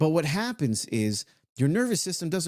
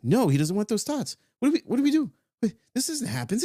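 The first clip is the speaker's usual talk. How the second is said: much higher, panicking little minion voice; freaking-out minion; 10 semitones higher than usual. Acted. Minions realise their thoughts are refused, squealing in panic. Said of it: "they're gonna be freaking out like".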